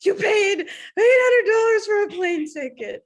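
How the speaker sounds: loud, high mock rant; apoplectic. Imitating colleague fed up with a runner's holey socks, apoplectic. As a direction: rail in exasperated disbelief, loud and high. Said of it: "he's, like, apoplectic".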